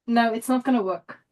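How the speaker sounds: ordinary delivery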